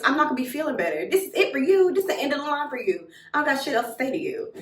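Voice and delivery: indignant dismissal. Retold or imitated. Betrayed girl ends the friendship, indignant. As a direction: cut the friend off for good. Cutting off friend in retold quarrel.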